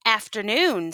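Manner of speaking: ordinary delivery, nothing marked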